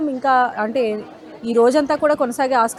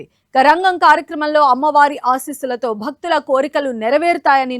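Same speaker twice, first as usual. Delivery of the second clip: crisp studio news anchor delivery; news read; 5 dB louder than usual. Acted. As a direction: read it like a TV bulletin. Anchor reads news bulletin.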